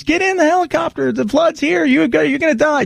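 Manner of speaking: hammed-up helicopter crew begging her to climb in; urgent rescuer. Helicopter crew begging Karen to get in, high and hammed-up.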